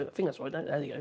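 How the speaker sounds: usual speaking voice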